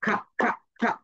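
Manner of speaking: খা repeated with aspirated k; letter sound kha. Shown as a demonstration. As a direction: drill "খা" three times for the class. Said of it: "কিউ sounds like খা".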